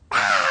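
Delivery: high, loud imitated toddler shriek laughing through it; mock toddler scream. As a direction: shriek like a cranky two-year-old. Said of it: "she started to scream again".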